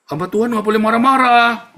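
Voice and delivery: loud, high-pitched imitated rebuke; mock scolding critic. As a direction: cry it out like an indignant objector.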